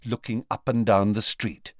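usual speaking voice

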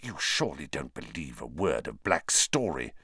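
low, soft hiss of a voice; whispered. Acted. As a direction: say it low and secretive. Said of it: "Snape whispered".

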